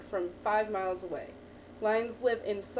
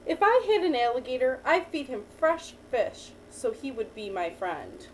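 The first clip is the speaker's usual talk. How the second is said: put-on reading to a child; 3 semitones lower than usual. Teacher reading a picture book to a child, lively storytelling. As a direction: read the picture book to the child, lifting each new animal.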